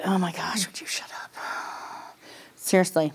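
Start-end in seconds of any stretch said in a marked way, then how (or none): 0.0-1.3 whispered complaint under the breath; imitating a whispered grumble in church; muttered whisper. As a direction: hiss it so nobody in church hears. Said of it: "just like".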